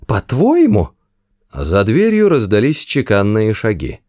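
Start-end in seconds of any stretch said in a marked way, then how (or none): 0.1-0.9 high startled question; alarmed. Acted. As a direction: jump at the words. Arthur alarmed.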